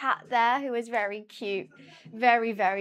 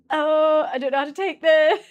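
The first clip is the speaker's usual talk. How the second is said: awkward disbelief; 3 semitones higher, 9 dB louder than usual. Retold or imitated. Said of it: "And I was like".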